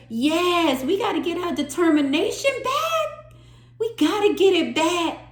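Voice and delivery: high and loud, fired-up insistence; excited rallying. Real, not acted. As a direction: pump everyone up to win it back. Rallying over the card reading.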